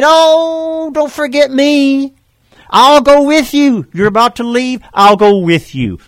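high, loud, pleading call; eager plea. Retold or imitated. Eager follower calling after Jesus, high and pleading. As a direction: chase after him, begging not to be left. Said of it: "run up to him and say".

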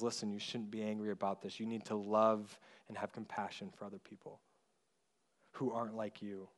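soft, low, tender admonishing; gentle fatherly correction. Retold or imitated. God as Father kneeling to us, softly and lovingly correcting. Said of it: "God, like our Father".

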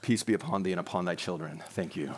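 very low, hushed monotone benediction; mock-solemn blessing. Pastor parodies church folk greeting each other, mock-solemn. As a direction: intone it with exaggerated piety, deep and soft.

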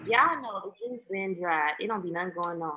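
muffled, bass-heavy mic sound; muffled bass only. Real, not acted. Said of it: "You sound muffled".

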